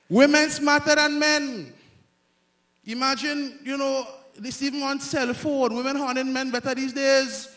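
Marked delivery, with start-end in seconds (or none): 0.1-1.7 slow loud punchline. Real, not acted. Repeats punchline louder.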